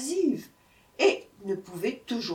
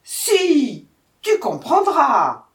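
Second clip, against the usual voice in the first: loud, high, forceful retort; furious tiger; 7 semitones higher, 11 dB louder, much slower than usual. A narrator's reading. Tiger enraged by the jackal's stupidity, furious. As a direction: shout it with drawn-out insistence. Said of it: "répliqua le tigre furieux".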